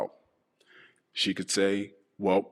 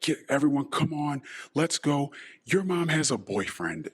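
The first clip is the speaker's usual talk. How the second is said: put-on half-replay the angry yell at the kids; blurting it out in a raised voice; retold yell; 8 semitones higher than usual. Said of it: "I screamed it through the house".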